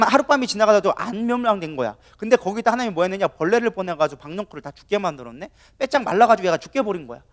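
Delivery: ordinary delivery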